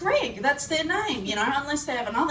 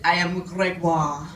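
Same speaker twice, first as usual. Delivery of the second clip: lightly mocked French accent, lower pitch; 5 semitones lower than usual. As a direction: introduce yourself as a caricature Frenchman. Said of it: "I met a Frenchman".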